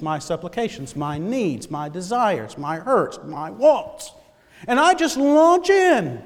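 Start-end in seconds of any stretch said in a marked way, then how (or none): 4.7-6.2 emphatic launch. Acted. Preacher mimics rushing into prayer.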